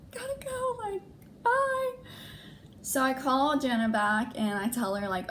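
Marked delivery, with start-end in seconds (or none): 0.1-1.9 very high, breezy rushed goodbye; hasty brush-off. Retold or imitated. Leaving work past the owner, high nervous brush-off. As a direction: squeak it out while rushing away.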